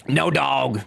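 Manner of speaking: ordinary delivery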